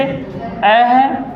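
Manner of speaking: slow, deliberate vowel demo. Shown as a demonstration. Teacher slowly demonstrating a short vowel. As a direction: sound out अ slowly for the class. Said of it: "अ है तो कम समय लग रहा है".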